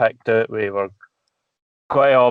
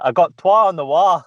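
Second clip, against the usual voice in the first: a light, raised mock Fife twang; mock Fife accent; 6 semitones higher, 5 dB louder than usual. Scottish man mimicking Fife locals with a light accent. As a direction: send up the Fife locals, a little louder. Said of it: "so it's like".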